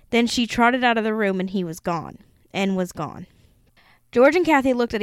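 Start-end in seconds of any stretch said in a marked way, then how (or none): none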